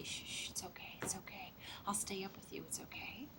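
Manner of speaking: soft hushed whisper, shh shh; soothing whisper. Retold or imitated. Parent comforting a child after a night terror, whispered. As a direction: whisper gently like rocking a scared child.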